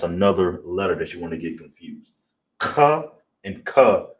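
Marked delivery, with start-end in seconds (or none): 2.6-4.1 Ka and ka said slowly and high, each one set apart; slow contrast of Ka and ka; contrasting sounds. Shown as a demonstration. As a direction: separate the two ka sounds carefully. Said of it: "two different letters you wanna pronounce".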